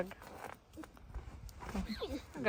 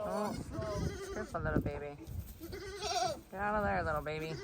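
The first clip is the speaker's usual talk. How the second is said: soft cooing; 10 dB quieter, faster than usual. Real, not acted. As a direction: gently coo at the little animal. Soft cooing at baby animal.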